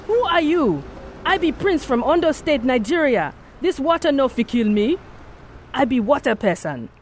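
put-on high roaring thick Yoruba accent. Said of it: "he roared in his thick Yoruba accent".

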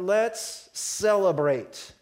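drawn-out happy cry to celebrate; joyful celebrating. Acted. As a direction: stretch it out with joy. Father exults over son's return.

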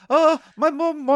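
put-on high, slow kid voice; squeaky little kid; child voice. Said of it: "He was basically like".

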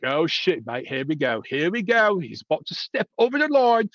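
hammed-up British accent that drifts Australian; attempted British accent. Mocking UK fans with a bad British accent. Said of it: "that was my attempt at it".

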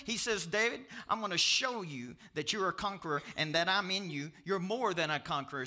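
loud, high-pitched pulpit delivery; full-force preaching. Real, not acted. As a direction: preach it out loud to the whole room.

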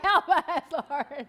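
mock desperate plea.

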